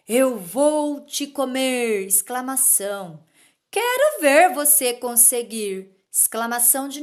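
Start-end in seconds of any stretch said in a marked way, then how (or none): 0.1-2.0 slow, louder threat from a scary ogre; ogre threatening. Acted. Ogre threatens the goat on the bridge, slow and loud. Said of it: "O ogro quer colocar medo". 3.7-5.9 louder, fearless dare; defiant goat. Acted. Goat dares the ogre, loud and fearless. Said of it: "e o cabrito desafia".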